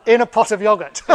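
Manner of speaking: loud and high through laughter; amused, laugh-filled exclamation; laughing punchline. Real, not acted. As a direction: blurt it out while cracking up. Delivering punchline between laughs.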